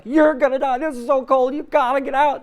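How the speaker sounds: high, loud panicked yelling; frantic voice screaming to get out; panicking reptile brain. Pretend. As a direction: yell in alarm at the cold. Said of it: "The reptilian brain is like".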